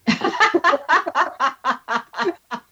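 a long, high, real laugh at her own story. Real, not acted. Guest laughing at her own Christmas present story, high laugh. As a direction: let a real laugh roll out, pitched high.

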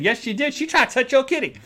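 high, sing-song tattletale; mock tattling. Tattling to the receptionist in a high voice. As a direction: tell on her like a kid.

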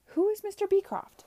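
high, loud alarmed cry. A narrator's reading. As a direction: burst out with an urgent question. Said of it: "cried Charlotte".

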